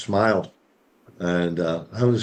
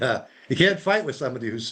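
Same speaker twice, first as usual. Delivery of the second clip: usual speaking voice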